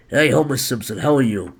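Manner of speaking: mock gruff chain smoker; Homer Simpson. Host guessing at Homer Simpson's voice, mock gruff. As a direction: lightly put on a smoker's gruff voice. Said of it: "he's a gruff chain smoker".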